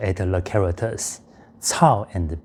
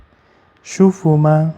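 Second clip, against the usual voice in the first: moaned question; 6 semitones higher, 6 dB louder than usual. Shown as a demonstration. Teacher acts it again, moaning.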